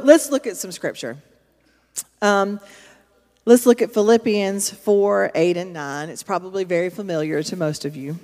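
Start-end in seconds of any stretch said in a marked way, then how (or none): none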